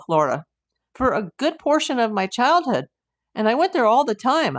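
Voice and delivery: light up at a familiar place; real excitement. Real, not acted. Streamer thrilled by viewer's Pensacola birthplace, excited.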